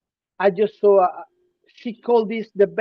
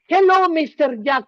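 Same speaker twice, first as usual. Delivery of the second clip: higher-pitched, hammed-up Mexican-accented English; exaggerated Mexican accent; 4 semitones higher than usual. Shown as a demonstration. Critic mockingly greeting the other man, exaggerated Mexican accent. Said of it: "You want me to talk like that?".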